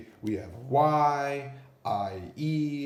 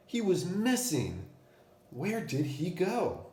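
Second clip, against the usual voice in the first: wondering; 7 semitones higher than usual. A narrator's reading. Suspense reading to class.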